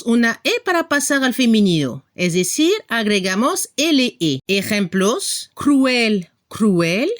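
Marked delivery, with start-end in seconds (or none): none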